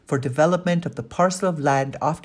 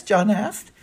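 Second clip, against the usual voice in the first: plain reading voice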